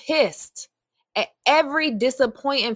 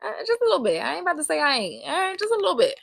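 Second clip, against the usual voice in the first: sheepish admission; 5 semitones higher, much faster than usual. Real, not acted. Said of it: "sound like I still am".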